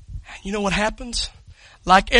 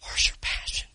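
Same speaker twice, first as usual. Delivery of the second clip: whisper; 8 dB quieter than usual. Real, not acted. Sudden hush after shouting.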